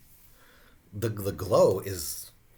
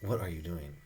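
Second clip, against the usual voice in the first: usual speaking voice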